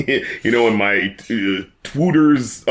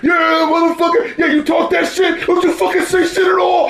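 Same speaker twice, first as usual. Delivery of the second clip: rowdy studio shouting. Retold or imitated. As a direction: yell like a rowdy crowd in the booth. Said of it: "they're like".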